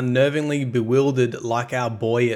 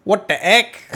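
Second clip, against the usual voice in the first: surprised; 9 semitones higher, 6 dB louder than usual. Real, not acted.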